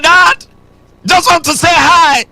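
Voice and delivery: put-on bright little boy calling his dad; child greeting dad.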